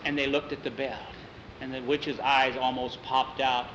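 ordinary delivery, nothing marked

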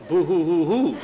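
spelled-out boo-hoo wail; teasing imitation of a child's sobs; put-on blubbering; mock crying. Mother mimics crying as threat.

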